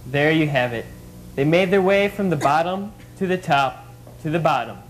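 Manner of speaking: very low, dramatic narrator voice; deep documentary narrator. Acted. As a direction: sign off like a doc narrator.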